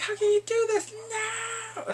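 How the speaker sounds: high, squealing mock tantrum; hammed-up outrage. Bully ranting at the hand-holding couple, mock outrage. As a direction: wail it in exaggerated betrayal.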